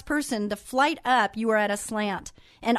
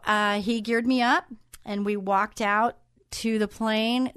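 normal talking voice

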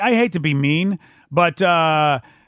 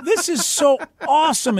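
gushing delight; 9 semitones higher than usual. Retold or imitated. Echoing fan's delight.

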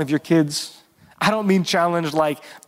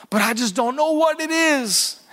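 mock complaining; 9 semitones higher than usual. Retelling someone's frustrated complaint.

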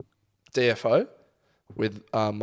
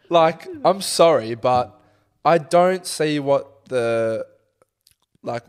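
normal talking voice

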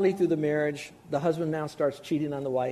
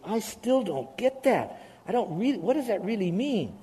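confused listener; 6 semitones higher than usual. Retold or imitated. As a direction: play the lost congregant, lightly. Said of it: "'cause some of you are saying".

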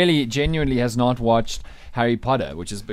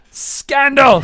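excited; 11 semitones higher, 7 dB louder than usual. Real, not acted. Excited retelling of monkey story.

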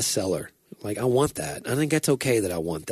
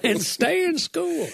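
high, slow, laughing preacher voice; mock preacher rhyme; slower than usual. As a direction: chime in with a silly rhyme. Joining the rhyme joke.

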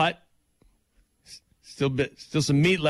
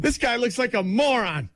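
high sneering heckler; mocking heckler; 10 semitones higher than usual. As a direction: sneer like a snob. Continues heckler voice.

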